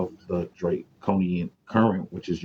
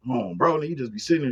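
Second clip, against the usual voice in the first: ordinary talk, nothing marked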